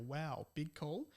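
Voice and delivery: ordinary delivery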